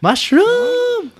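long high shout of the name. Acted.

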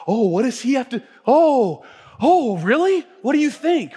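high, loud, gushing mock admiration; mock fawning awe. Preacher mocking how we hang on others' opinions, gushing and high. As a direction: gush over someone's opinion like a starstruck fan.

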